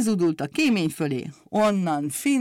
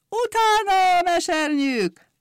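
rallying cry; 11 semitones higher, slower than usual. Acted. Umbrella bird rallies the umbrellas, a high slow rallying shout. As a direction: sweep the flock after you with a slow, soaring shout.

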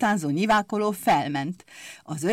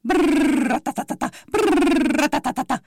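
higher, louder mock engine brrr tatata; grinning motorbike rattle; 7 semitones higher, 3 dB louder than usual. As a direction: mimic the motorbike with a grin. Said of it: "Berregett vigyorogva". (vigyorgó motorberregés)

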